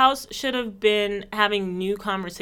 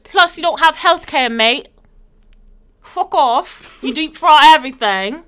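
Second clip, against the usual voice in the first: loud mock British insult, higher; loud, higher mock London jeer; 6 semitones higher, 10 dB louder than usual. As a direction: jeer like a smug Brit. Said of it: "And then they're like".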